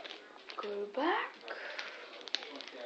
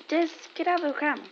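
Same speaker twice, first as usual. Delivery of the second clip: quick scold; 4 semitones higher, 5 dB louder, much faster than usual. Real, not acted. Scolding the other dog.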